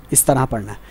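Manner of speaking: usual speaking voice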